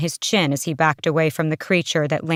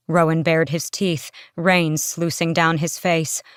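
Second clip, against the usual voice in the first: usual speaking voice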